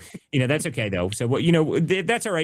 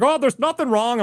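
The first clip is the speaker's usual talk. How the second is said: high, airy mock of a dismissive politician; mocking DeWine; 12 semitones higher than usual. Host mocks DeWine waving off the fraud, high mock voice. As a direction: brush it off in a falsetto mock. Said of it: "It's like".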